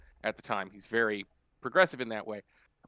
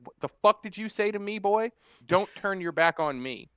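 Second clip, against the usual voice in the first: raised, louder menacing growl at a younger man; retold angry challenge; 7 semitones higher, 5 dB louder than usual. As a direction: confront him hard, higher and louder.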